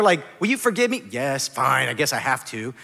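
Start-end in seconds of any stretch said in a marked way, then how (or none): 1.1-2.7 lower, reluctant giving-in; mock grudging assent. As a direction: give in as if forced. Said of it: "She was still mad".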